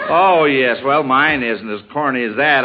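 usual speaking voice

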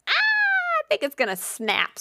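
very high, slow worried squeak. Retold or imitated. Retelling her worry that the TV stand would snap, very high slow squeak. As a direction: squeak it out as the stand starts to give.

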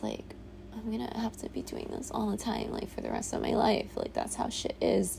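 whispering it close to the mic; hushed whisper. Real, not acted. Vlogger confiding to her camera, whispered. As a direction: drop to a near whisper as if confiding.

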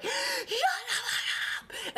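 put-on high, slow, strained yell at the baby; exasperated parent snap. Mom snapping at her crying newborn, high strained yell. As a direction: let the built-up rage burst out. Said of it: "then you're just like".

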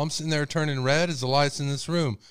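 ordinary talk

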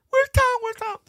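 put-on very high frantic child calling out; panicked kid. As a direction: cry out in panic like a small boy. Kid panicking over lost cat.

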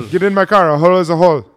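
lightly voiced brute cab driver; taxi driver voice. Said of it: "taxi driver".

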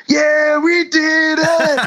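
drawn-out victory yell; shouted quote. Retold or imitated. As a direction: belt it out like a rock star celebrating. Quoting band outtake, shouting.

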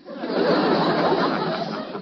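long booming belly laugh; hearty laugh. Acted. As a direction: roar with laughter. Laughs at the stick joke.

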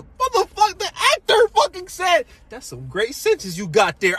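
excited high-pitched yell; hyped shouting. Retold or imitated. Fan shouting a favorite movie line to friends, hyped shouting. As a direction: holler it in amazed hype. Retelling the film's big line.

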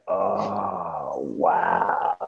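low, soft letdown; deflated reaction. Retold or imitated. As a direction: take the bad news, sinking in pitch. Reacts to news they passed.

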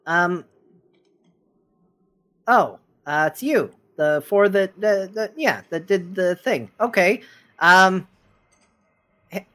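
high, eager little gnome; squeaky gnome voice. Acted. Gnome recognizing the party at the bar, high and squeaky. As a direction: chirp it up high and nervous.

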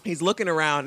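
ordinary talk

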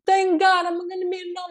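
high-pitched, relieved mom voice; overjoyed mother. Acted. Mother hears of the wedding a second time, overjoyed. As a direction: exclaim it high as the thrilled mother. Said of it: "And she's so happy".

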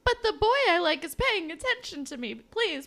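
put-on high, pleading teen voice; needy teen self. Mimicking her teen self craving a boy's attention, high and pleading. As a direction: beg for his attention. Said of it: "I was definitely like".

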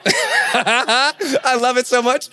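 slow, laughing through the words. Real, not acted. Speaker laughing at how athletes doubt him.

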